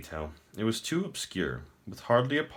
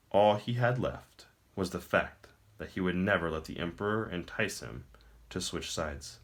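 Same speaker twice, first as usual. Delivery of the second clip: ordinary delivery, nothing marked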